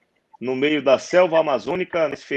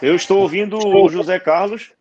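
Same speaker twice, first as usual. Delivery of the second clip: raised, higher voice over a bad line; to someone hard of hearing; 6 semitones higher, 6 dB louder than usual. Real, not acted. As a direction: speak up so they can hear you. Answering over a bad connection.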